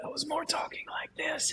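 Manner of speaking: a breathy whisper with no voice at all; barely there, just air. Shown as a demonstration. Showing how weakly he answered the nurse, in a whisper. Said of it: "It was like a whisper".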